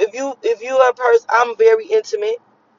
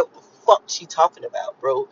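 unvoiced mock mumble of confused people; confused listeners; 6 semitones lower, 4 dB quieter than usual. Imitating people who don't follow her talk, whispered. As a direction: mutter it like a baffled listener. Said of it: "people like".

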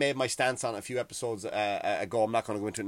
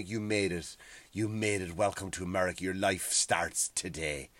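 mock tender welcome; 7 semitones lower, 7 dB quieter than usual. Someone putting blanket around immigrant.

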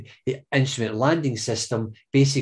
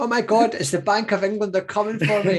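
mock panic; 9 semitones higher than usual. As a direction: fake terror, voice shooting up. Mock panic about Bank of England.

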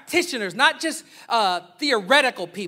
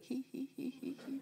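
teasing giggle, then a quiet jab; mock giggle; 5 semitones higher, 17 dB quieter than usual. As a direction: giggle at the churchy word. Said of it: "so I just be like".

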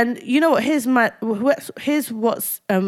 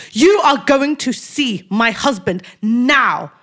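slow, emphatic retold demand; insistent ultimatum to a doctor; making a scene; 3 semitones higher, 4 dB louder, slower than usual. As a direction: hit every word, stretch out NOW. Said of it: "proper making a big scene".

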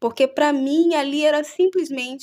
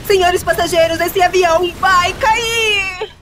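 loud, high, slow panic; panicked announcement; 8 semitones higher, 7 dB louder, slower than usual. Pretend. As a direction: shout the crash warning in alarm.

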